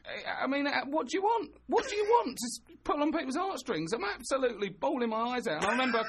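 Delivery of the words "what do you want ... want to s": ordinary talk, nothing marked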